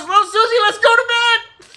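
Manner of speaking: high, hammed-up holler to his wife; excited calling out. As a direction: shout it gleefully, stretching the end. [name] acted calling to his wife.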